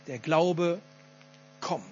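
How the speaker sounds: „kommt“ nach deutlicher Pause allein gesetzt; Betonung auf „kommt“. Shown as a demonstration. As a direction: setz vor „kommt“ ab und lass es stehen. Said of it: "das ist ganz wichtig". („kommt“ bedeutungsvoll abgesetzt)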